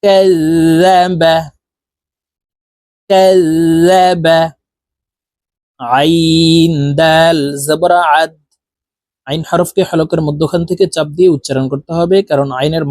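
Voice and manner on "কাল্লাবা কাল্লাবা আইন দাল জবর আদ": spelling drill. Shown as a demonstration. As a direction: spell it out clearly for students to repeat. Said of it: "আমার সঙ্গে বলার জন্য চেষ্টা করুন". (ধীরে ধীরে বানান করে বলা)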